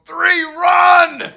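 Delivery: high, loud shout; shouted escape. Retold or imitated. Narrator shouting to flee the gunman, high and loud. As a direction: yell it and bolt. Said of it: "and I go".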